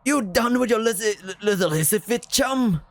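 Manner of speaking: mock pirate sneer on "chum"; piratey voice. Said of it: "chums is more like a piratey thing".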